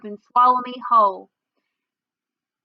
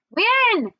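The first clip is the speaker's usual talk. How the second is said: put-on eager child; 10 semitones higher than usual.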